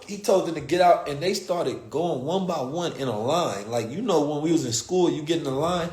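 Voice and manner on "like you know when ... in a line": normal talking voice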